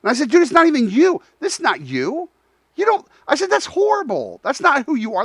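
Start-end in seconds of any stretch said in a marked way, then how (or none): 0.3-3.1 acted-out disbelief, pitched up; retold exasperation. Retold challenge to the trainer. 3.5-5.2 high-pitched retold dismay; appalled reaction. Retold challenge to the trainer.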